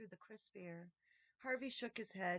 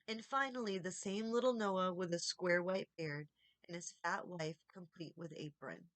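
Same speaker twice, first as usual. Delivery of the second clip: usual speaking voice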